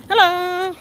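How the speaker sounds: sudden high hello to someone passing; high startled hello while out walking; surprised hello. Real, not acted. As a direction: pop out a bright hello. Sudden greeting mid-story.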